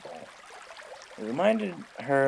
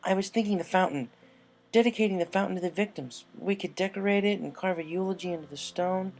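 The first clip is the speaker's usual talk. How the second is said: eager, pitching an idea fast; Rainbow Dash voice; 7 semitones higher than usual. Acted. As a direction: Rainbow bursting in to pitch her idea to Rarity.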